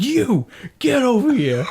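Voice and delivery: high, slow shouted order; retold yell at a kid sneaking away; angry dad summons. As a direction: stop the child with a stretched-out "you". Retells calling son back angrily.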